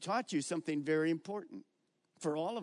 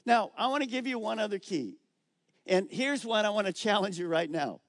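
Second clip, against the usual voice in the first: ordinary talk, nothing marked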